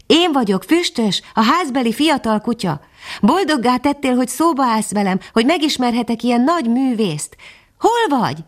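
put-on young dog introducing himself to the bird; eager young dog. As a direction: speak like a young, awed puppy. Young dog voiced in story.

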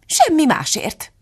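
high, slow, dismissive answer; drawn-out, imperious retort; Duchess's curt reply. A narrator's reading.

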